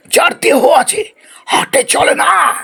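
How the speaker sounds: high, slow shouted line; booming riddle. Acted. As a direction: proclaim the riddle grandly. Riddle continues dramatically.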